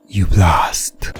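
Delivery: usual speaking voice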